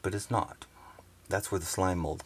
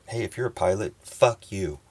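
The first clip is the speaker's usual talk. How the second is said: louder, defiant jab; loud insult; 3 semitones higher, 7 dB louder than usual. Real, not acted. Telling pilots off, louder and defiant. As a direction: call it out louder to any pilot.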